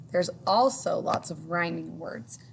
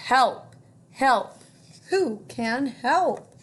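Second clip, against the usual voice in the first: loud cries for help; duck calling for help; 5 semitones higher, 6 dB louder, much slower than usual. Acted. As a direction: shout it out for help.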